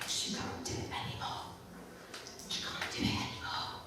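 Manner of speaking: hushed whispered gossip. Retold or imitated. Imagined colleagues gossiping about her, whispered. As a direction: whisper it like colleagues talking behind her back.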